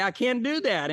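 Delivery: a mock-whiny believer; whiny refusal. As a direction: whine back at God. We refuse God.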